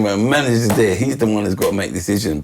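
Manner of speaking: ordinary delivery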